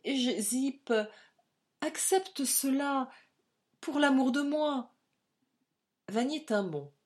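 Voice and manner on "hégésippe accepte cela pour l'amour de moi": higher, slow, emotion tightening the throat; voice choked with emotion. A narrator's reading. Louise begging Hégésippe to keep the money, voice choked. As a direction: plead with her voice caught. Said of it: "d'une voix étreinte par l'émotion".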